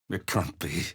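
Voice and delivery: softly stunned, unbelieving; hushed disbelief. Acted. Parent doubting the lost child is real, hushed disbelief. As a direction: let it out quietly, in disbelief.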